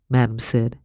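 plain reading voice

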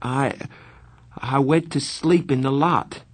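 stammering. A narrator's reading. Pony confessing to angry Darry, stammering. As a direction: falter after the first word, then admit it. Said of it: "when I stammered".

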